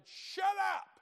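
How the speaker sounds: high, loud snapped shut up; fed-up patient yelling at his roommate; angry shout. Retold or imitated. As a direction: bark it at the hollering roommate. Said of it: "I said".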